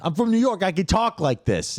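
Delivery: high, mock New York accent. Echoing his cohost's bit in a hammed-up New York accent.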